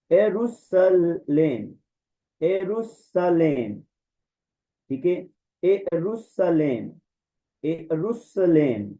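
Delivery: very slow एरुसलेम, each vowel apart; ए-रु-स-लेम, separate vowels; slow drilled pronunciation. Shown as a demonstration. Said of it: "दोनों की sound को अलग अलग".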